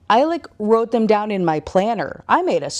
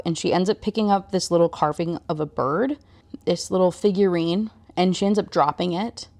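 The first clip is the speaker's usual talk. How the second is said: ordinary delivery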